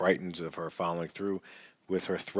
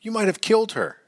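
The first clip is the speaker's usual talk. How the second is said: high, loud horrified scream; 13 semitones higher, 5 dB louder than usual. A narrator's reading. Wife screams at husband who shook their baby, high and loud. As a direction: shriek it in terror at him. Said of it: "she screamed".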